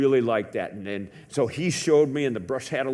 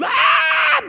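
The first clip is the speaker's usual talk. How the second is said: sudden high, loud yelp of pain; childish scream; 9 dB louder than usual. Retold or imitated. Boy bitten by a horse screams in pain, high loud yelp. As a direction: scream like a bitten ten-year-old. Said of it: "I screamed like the eight-year-old".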